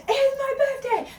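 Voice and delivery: very high, loud birthday holler; re-enacted shout. Birthday girl to people they go past, high re-enacted shout. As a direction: holler it gleefully at passers-by.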